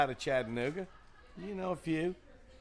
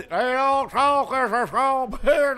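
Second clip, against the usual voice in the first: a mock mumbly Southern drawl, higher and slow; imitating a Southern comic's mumble mouth; 12 semitones higher, 12 dB louder, slower than usual. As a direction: slur it like a thick-accented Southern comic. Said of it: "that mumble mouth".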